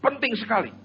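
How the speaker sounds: imitated woman's plea, pitched high; urgent request. Mother asking photographer to fetch her husband, urgent request. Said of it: "Dia titip pesan sama orang ini".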